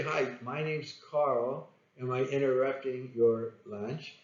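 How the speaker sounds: slow, soft, matched pace; slow right down to mirror a slow talker; calm slow phone opener matching the prospect; matching a slow speaker. Shown as a demonstration. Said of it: "match their speed".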